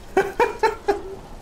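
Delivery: high airy giggle; laughing it off; short squeaky laugh; breathy laugh. Real, not acted. Laughing after near miss.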